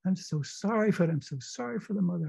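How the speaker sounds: put-on high, pitying voice over a dead child; tender grief. A narrator's reading. Rereading Ada's grieving speech.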